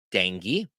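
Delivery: "Danke" said as DANG-ee, ending in ee; "Danke" with the vowel of "dang", then ee; regional pronunciation. Shown as a demonstration. Teacher shows one regional thank you, "Danke" as DANG-ee. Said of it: "sometimes hear people say".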